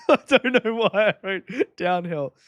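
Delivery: talking through real laughter; laughing. Real, not acted. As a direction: giggle helplessly at your own mistake. Laughing at own typo.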